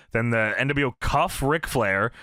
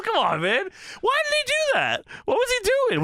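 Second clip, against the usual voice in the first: incredulous. Real, not acted. Laughing disbelief at Vincent.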